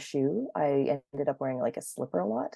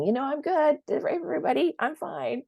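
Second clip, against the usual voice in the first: mock cheerful body; 11 semitones higher than usual. Body voiced as reassuring.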